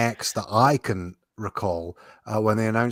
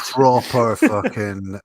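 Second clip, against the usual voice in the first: usual speaking voice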